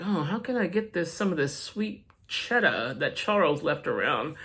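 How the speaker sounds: low, soft scheming murmur; a hushed greedy aside to himself; sly murmur. Retold or imitated. Agent muttering to himself about Charles's money, soft and sly.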